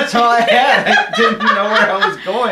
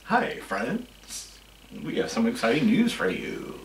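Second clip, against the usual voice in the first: low and soft, like someone just died; gloomy; 10 semitones lower, 11 dB quieter than usual. Retold or imitated. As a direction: say it like a funeral announcement. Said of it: "That was like someone just died".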